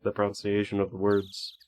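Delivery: ordinary talk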